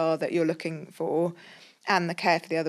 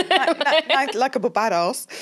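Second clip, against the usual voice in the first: mock American attempt; 7 semitones higher, 6 dB louder than usual. Shown as a demonstration. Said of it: "doesn't sound very good in an English accent".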